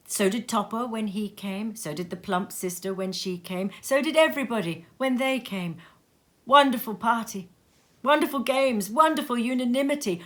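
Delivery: plain reading voice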